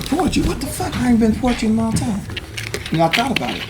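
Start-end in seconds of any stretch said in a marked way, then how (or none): 0.0-2.2 high, fast baffled outburst; baffled disbelief. Retold or imitated. Mishearing the station name, baffled high and fast. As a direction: rattle it off high in confusion. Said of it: "I say".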